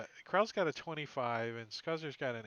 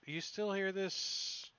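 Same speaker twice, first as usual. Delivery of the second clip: ordinary talk, nothing marked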